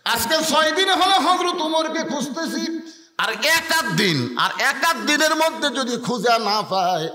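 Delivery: impassioned preaching. Acted. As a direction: proclaim it to the congregation. Preacher re-declaims the story line.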